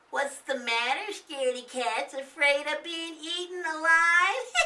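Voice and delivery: high, mocking little boy; teasing. Nathan teasing his scared siblings, high and mocking. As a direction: tease the scaredy cats. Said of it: "Nathan canted".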